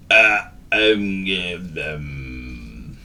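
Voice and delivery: drawn-out ums, fading away; puzzled dog lost for words; forgetful hesitation. Acted. Dog cannot remember the word.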